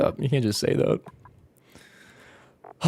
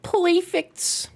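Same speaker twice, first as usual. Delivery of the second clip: a mock high-voiced approval; high squeaky perfect; 13 semitones higher than usual. Streamer approving in a silly high voice. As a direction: pop it up high.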